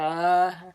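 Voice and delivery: loud drawn-out "ah"; imitated open-mouthed cry. Boy as the monster enters his mouth, imitated loud cry. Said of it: "he's like".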